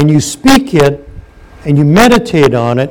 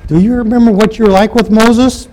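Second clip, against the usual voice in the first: lightly voiced doubtful Joshua, pitched up; a hint of skeptical Joshua; 6 semitones higher than usual. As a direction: tease them as Joshua would, mildly. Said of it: "if I was Joshua... I'd go".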